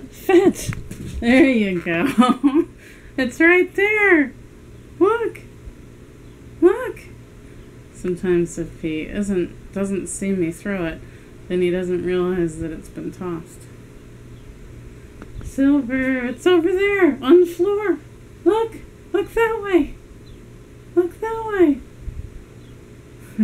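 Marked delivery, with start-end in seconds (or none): 0.1-7.0 higher, louder coaxing the dog. Real, not acted. Owner telling her dog Silver the toy is over there, high and loud. 15.4-23.4 higher, louder coaxing the dog. Real, not acted. Owner telling her dog Silver the toy is over there, high and loud.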